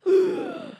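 loud, high intake of breath; shocked gasp. Retold or imitated. Realizing what he felt inside her, horrified gasp. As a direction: gasp in dramatic horror.

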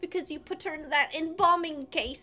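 put-on rambling theatrical child voice; lightly voiced earnest child. Child reminding mother about Mary Poppins, mock-earnest pleading.

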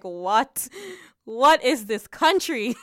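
retold childhood shock, slow and high; baffled kid asking what kind of place this is; incredulous, drawn-out what; shocked disbelief. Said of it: "I was just like".

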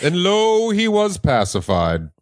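high "lo", then slow solemn close; mock scripture reading. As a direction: proclaim it like a biblical verse.

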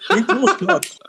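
high, whiny mock of a tall person; mocking tall people. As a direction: sneer it like a smug tall guy's excuse.

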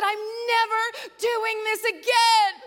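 high, loud, drawn-out acted outburst; exasperated vow. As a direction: reenact swearing off it at full voice. Vowing after painful childbirth, retold.

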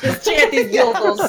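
laughing mock Swedish accent; Squizgar. Co-host repeating the joke, laughing Squizgar-style Swedish accent. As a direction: echo it as a cartoon Swede, laughing. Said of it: "that sounded more like Squizgar".

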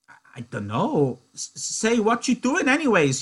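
anxious cry of a simple giant; Lenny crying out. Acted. Lenny crying out at Crooks in alarm. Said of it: "he cried".

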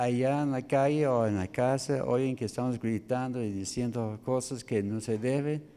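ordinary talk

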